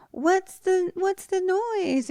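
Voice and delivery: put-on very high, drawn-out child's question; little kid voice. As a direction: imitate a small boy just woken. Voices small child Mac waking up.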